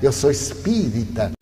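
low, soft and calm; quiet calm with no fear. Retold or imitated. Speaker facing the armed robber, low and soft. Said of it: "o senhor me olhou muito calmo".

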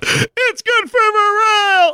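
high, slow crying shout; whiny soldier. Acted. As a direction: wail it out to the general.

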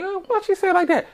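very high-pitched mock puzzlement; high, wondering aside to yourself; puzzled inner reaction. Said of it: "I'm like".

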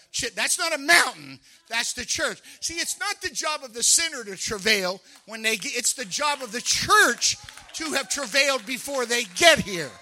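loud, high, fervent preaching; a pastor shouting the point home to his church; full-force preaching. Real, not acted. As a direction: preach it out with rising fire.

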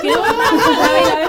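high, loud, drawn-out laughing cry. Real, not acted. Mocking her teenage infatuation to friends, laughing high. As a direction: let it burst out in a laugh.

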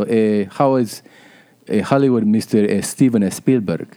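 hesitant Peruvian-cousin impression; Peruvian accent. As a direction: put on a Spanish-speaking Peruvian accent. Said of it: "My foreign cousins".

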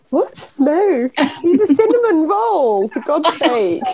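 high-pitched incredulous protest, breaking into laughter; mock outrage. Host defending a misconstrued character, mock outrage.

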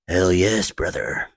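loud trailer-announcer answer; movie trailer voice. Acted. Trailer guy confirms to a fan, in movie trailer voice. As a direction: boom it like a trailer narrator, dropping at the end. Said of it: "The movie trailer voice?".

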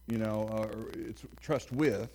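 ordinary talk, nothing marked

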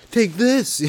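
high, hammed-up battle cry; mock challenge. Acted challenge to [name].

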